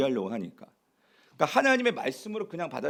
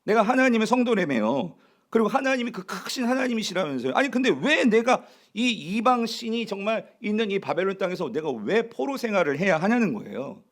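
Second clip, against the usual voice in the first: higher-pitched acted protest to God; resentful complaint; 4 semitones higher than usual. Exiled Israelites complaining to God, aggrieved. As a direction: plead and grumble, imitating an aggrieved believer. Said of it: "막 원망 불평하는데".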